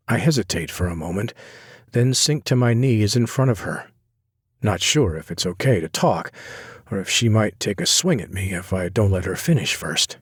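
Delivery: ordinary delivery, nothing marked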